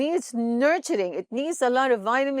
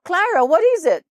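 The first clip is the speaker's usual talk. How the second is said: startled, loud and higher call to his wife; alarmed husband; 7 semitones higher, 8 dB louder than usual. Retold or imitated. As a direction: wake with a jolt and cry out. Husband jolted awake, alarmed.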